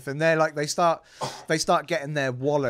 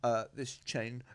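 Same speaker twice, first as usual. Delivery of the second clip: a hint of a posh show-off; lightly voiced rich kid listing prices; 7 dB quieter than usual. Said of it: "I don't know why you're doing the posh voice".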